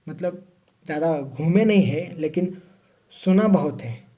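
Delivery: ordinary talk, nothing marked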